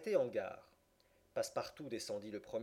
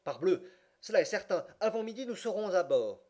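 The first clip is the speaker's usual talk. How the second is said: higher, louder exclamation to himself; convinced self-talk; 7 semitones higher, 5 dB louder than usual. A narrator's reading. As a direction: burst out with sudden certainty. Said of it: "ne put s'empêcher de se dire à lui-même". (exclamation convaincue, plus aiguë et forte)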